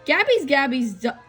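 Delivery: plain reading voice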